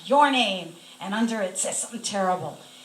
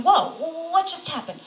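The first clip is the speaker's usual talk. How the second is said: high, louder startled wonder; retold astonishment; 8 semitones higher, 5 dB louder than usual. Patient recalls thinking aloud on her bed, astonished. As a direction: act out a stunned reaction.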